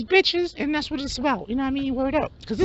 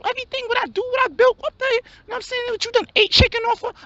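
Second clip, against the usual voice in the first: hustler friend; 5 semitones higher than usual. Acted. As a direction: shoot it up high in disbelief. High-voiced hustler storms off.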